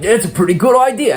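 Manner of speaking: Raphael voice. Retold or imitated. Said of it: "Raphael's like".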